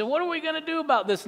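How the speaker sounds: quick high country question; high fast good ol' boy; tow truck driver Bubba. Retold or imitated. Bubba asks about the car.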